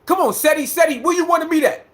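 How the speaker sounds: high-pitched challenge to fight; Umar. Retold or imitated. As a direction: mimic a Philly man calling someone out. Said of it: "Umar talk like that".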